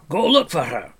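high, loud growling dwarf; growled. Acted. Dwarf scolds Taran over the pig, growling. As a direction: growl it loudly at the youngster. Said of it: "growled Dolly".

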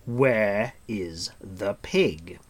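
very slow, firm demand. A narrator's reading. Gwydion insisting on an answer, slow and firm. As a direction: spell it out firmly. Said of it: "said Gwydion firmly".

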